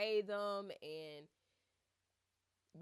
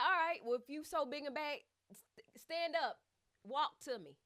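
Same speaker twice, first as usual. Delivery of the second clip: loud, much higher, barking orders like a strict therapist; stern, assertive Holly; 6 semitones higher, 5 dB louder than usual. Retold or imitated. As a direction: drill him like a no-nonsense physio. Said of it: "stern, assertive".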